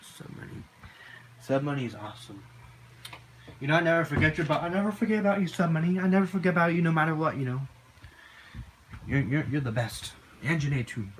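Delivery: ordinary talk, nothing marked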